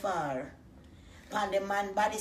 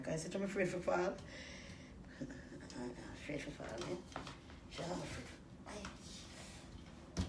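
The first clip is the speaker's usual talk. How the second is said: fading fearful murmur; 4 semitones lower, 13 dB quieter than usual. Retold or imitated. Fear of falling, voice drops.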